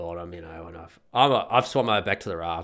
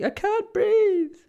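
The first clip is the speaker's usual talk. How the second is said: very high, drawn-out panicked squeal; stretched-out falsetto shriek; mock scream; 3 dB louder, much slower than usual. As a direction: imitate a hysterical woman screaming.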